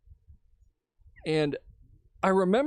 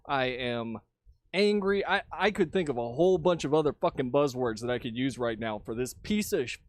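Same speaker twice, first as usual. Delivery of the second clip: normal talking voice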